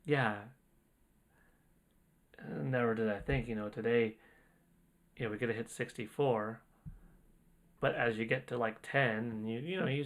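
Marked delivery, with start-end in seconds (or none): 0.0-4.2 awed and quiet. Real, not acted. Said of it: "out of body experience here".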